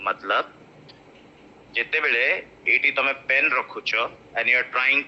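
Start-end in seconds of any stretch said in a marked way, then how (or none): none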